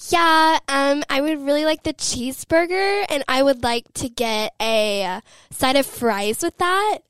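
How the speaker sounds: high, overdone American ordering food; mock a Brit trying an American accent; imitated American accent. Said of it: "trying to talk in an American accent".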